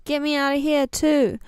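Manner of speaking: ordinary delivery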